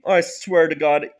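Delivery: ordinary talk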